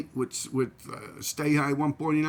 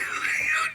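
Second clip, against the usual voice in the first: high-pitched burst of laughter; real high giggling laugh. Real, not acted. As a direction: crack up at your own story. Cracks up at sickly pigeon.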